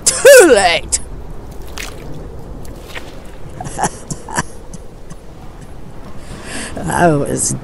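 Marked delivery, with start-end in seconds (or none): 0.0-1.0 shout. Acted. Ranting monologue at the sky. 1.0-6.7 mad laugh. Acted. After shouting at the sky.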